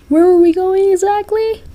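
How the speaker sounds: high, loud frightened question; fearful. A narrator's reading. Listener flying with Jack to unknown place, fearful. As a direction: ask it in a panicky high voice. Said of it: "you said in fear".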